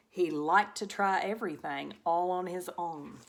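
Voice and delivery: plain reading voice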